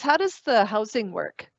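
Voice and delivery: usual speaking voice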